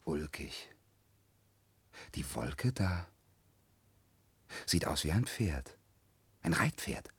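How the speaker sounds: hushed, low murmur; nearly whispered, with long pauses; dreamy musing. Acted. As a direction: wonder softly at what appears above. Musing alone at clouds.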